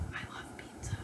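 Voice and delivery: a quick whispered personal aside. Real, not acted. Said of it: "whispered aside".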